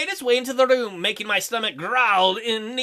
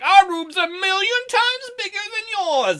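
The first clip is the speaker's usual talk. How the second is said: high, slow mocking quote; hammed-up sing-song taunt; mocking boast; 9 semitones higher than usual. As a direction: mimic a bragger, pitched way up and drawn out. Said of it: "We're like".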